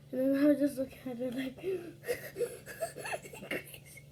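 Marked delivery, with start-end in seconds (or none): 1.6-3.4 high laugh. Real, not acted. Laughing at the situation.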